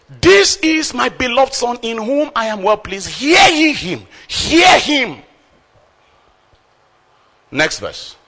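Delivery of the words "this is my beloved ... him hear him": high, loud climactic declaration; preaching shout. Real, not acted. Preacher proclaiming God's command to the congregation, shouting. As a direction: proclaim it to the rafters. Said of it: "preaching climax".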